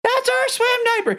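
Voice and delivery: very high-pitched squealed call; mock excited shout. Mom almost shouting out the car window, high mock shout. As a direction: imitate yelling out a window in a squeal. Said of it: "I almost shouted out the window".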